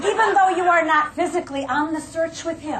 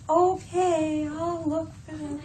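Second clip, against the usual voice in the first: depressed husband; 6 dB quieter, slower than usual. Retold or imitated. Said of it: "he gets kind of depressed".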